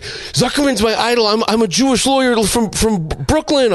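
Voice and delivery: gushing fan. Retold or imitated. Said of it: "he's like".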